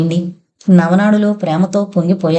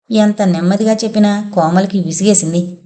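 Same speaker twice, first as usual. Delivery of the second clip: ordinary delivery, nothing marked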